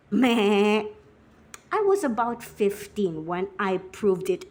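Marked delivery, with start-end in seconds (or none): 0.1-0.8 loud bleating animal noise; imitated bleat. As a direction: bleat it out to cap the animal chorus. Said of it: "listening to this".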